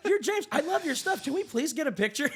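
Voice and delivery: very high, loud, excited fan voice; gushing fan. Retold or imitated. Fan begs James for a picture, very high and gushing. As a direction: beg for the photo like a starstruck fan.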